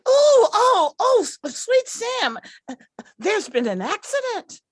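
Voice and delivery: high panicked oh-oh-oh; alarmed squeal. Acted. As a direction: shriek in flustered alarm. Becky alarmed by accident.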